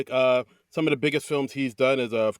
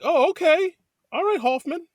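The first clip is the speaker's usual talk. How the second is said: very high, rising oh okay; mock-impressed, voice leaping up; impressed surprise; 3 dB louder than usual. Viewer impressed by a shirtless actor, squeaking high. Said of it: "I was like".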